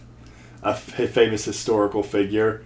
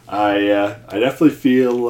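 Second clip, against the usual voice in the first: usual speaking voice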